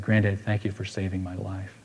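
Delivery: soft, hushed thank-you; whispered thanks. Retold or imitated. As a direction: say it quietly into an ear. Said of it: "whispered in his ear".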